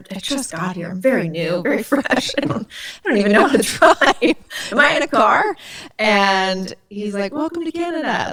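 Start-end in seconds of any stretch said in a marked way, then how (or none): 0.1-5.6 high, hammed-up helpless newcomer; mock-innocent squeal to an officer; playing clueless. 7.3-8.3 high, chirpy mock welcome; sing-song retold greeting; imitated friendly cop.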